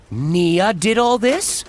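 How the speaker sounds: slow, stunned reporter question; disbelief. Acted. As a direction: ask it slowly in disbelief. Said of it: "Gale asked in disbelief".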